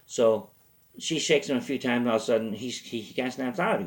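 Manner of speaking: ordinary talk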